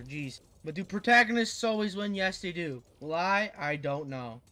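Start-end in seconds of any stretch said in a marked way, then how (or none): none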